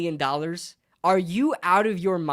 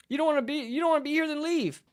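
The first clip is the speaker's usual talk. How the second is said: high, rapid, softer mimicry; mocking fans; 6 semitones higher, 5 dB quieter, faster than usual. Fan mimicking loyalists who dismiss complaints, mocking.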